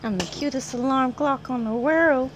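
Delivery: normal talking voice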